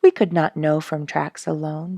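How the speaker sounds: plain reading voice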